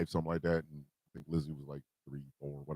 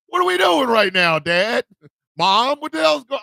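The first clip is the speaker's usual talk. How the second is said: laughing; 7 semitones higher, 6 dB louder than usual. Real, not acted. Laughing at parents' rat scene.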